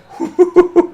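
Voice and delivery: short burst of high laughter; a quick, surprised laugh; sudden high laugh. Real, not acted. Laughs at the match.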